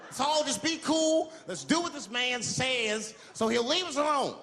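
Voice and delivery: high, slow calming voice; high reassuring plea. Retold or imitated. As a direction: soothe the crowd nervously. Calming the panicked bus.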